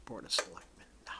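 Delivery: whispered aside to herself; barely voiced, under the breath; whispering. Real, not acted. Muttered aside while checking names.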